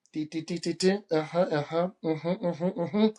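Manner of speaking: calculator beeps. Retold or imitated. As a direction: tap out sums under your breath.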